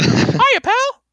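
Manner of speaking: very high falsetto cartoon greeting; Mickey Mouse impression. Host shows her cohost her Mickey, very high falsetto. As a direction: chirp it like the famous mouse. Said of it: "That's my Mickey impersonation".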